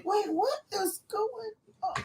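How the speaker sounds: high, soft squeal of confusion; mock bewilderment. Drinkers suddenly noticing frogs in the water, acted bewilderment. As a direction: act out sudden puzzlement in a small high voice. Acts out people finding frogs.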